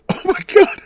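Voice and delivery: high, loud excited squeal; excited shriek. Real, not acted. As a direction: squeal with sudden fan delight. Shocked by Thing reveal.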